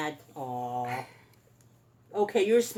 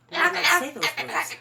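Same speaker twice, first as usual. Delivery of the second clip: higher, louder and fast, laying down the rule; raised scolding; 8 semitones higher, 7 dB louder, faster than usual. Real, not acted. Owner telling her parrot off for bad words, higher and louder. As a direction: scold the bird with a lifted voice.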